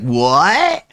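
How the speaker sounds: high, slow goofy gasps; mocking dumb reactor. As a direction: play the clueless straight man, wide-eyed. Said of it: "the straight man who's like".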